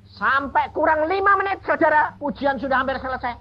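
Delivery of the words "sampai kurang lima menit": usual speaking voice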